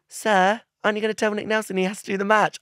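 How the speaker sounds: weird take. Retold or imitated. Actor on set delivering his one line, high fast blurt. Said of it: "And I say it like that".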